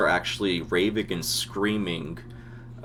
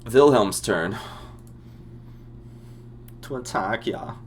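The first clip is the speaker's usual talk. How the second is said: GM voicing a German soldier with accent; thick Germanic accent; 5 semitones higher, slower than usual. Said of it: "like a French-German bastard".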